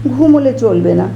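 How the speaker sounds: ordinary delivery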